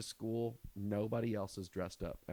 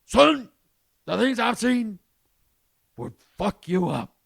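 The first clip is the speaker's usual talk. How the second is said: a hammed-up, much higher and louder grizzled veteran; haunted Vietnam vet; 11 semitones higher, 13 dB louder than usual. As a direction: lay on the war-weary soldier act hard. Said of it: "said it like he was a fucking Vietnam vet".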